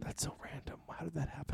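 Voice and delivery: hushed whisper. Real, not acted. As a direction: say it under your breath. Whispered aside.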